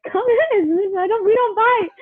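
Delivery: imitated drummer coaxing. Drummer inviting a frozen fan in, high coaxing imitation. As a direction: coax a shy fan in, high-pitched.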